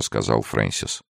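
plain reading voice

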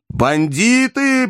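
high, slow shout. A narrator's reading. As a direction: yell the insult. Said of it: "прокричал Иван".

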